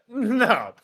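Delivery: hammed-up teasing; teasing Gojo. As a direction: drawl the insult. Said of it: "Gojo was like".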